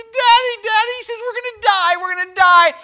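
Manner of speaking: high, loud imitated cry of panic; crying child. Boy crying to his dad in a storm, imitated panicked wail. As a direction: mimic a terrified boy wailing. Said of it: "and he's crying".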